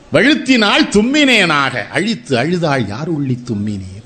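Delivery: slow recitation. Shown as a demonstration. Recites the sneeze couplet slowly.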